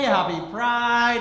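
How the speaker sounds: normal talking voice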